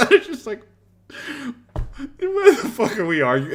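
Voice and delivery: high, loud laughing outburst; cracking up in disbelief. Real, not acted. Streamer laughing at the tequila argument, incredulous.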